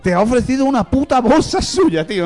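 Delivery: high, loud, building incredulous rant with a laugh; outraged disbelief. Retold or imitated. Scolding his friend for taking the film, rising in disbelief. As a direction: escalate the outrage as you go.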